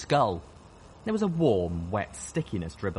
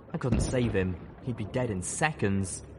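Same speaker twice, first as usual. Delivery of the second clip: usual speaking voice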